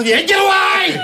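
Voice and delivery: high, indignant yelp; mock angry snap. Arguing couple, shoulder bumped, high mock yell. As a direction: shove them off with your voice.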